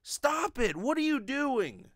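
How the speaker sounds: ordinary delivery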